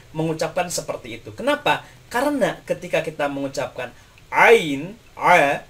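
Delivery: usual speaking voice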